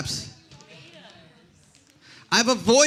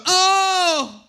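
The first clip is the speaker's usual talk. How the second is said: high loud mock "Oh!" of applause; mock cheer; 9 semitones higher, 4 dB louder than usual. Comic mocking the crowd, high whooping cheer.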